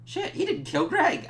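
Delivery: high, shocked yelp of relief; surprised cry. Retold or imitated. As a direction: squeak it out in disbelief. Imagined relieved reaction.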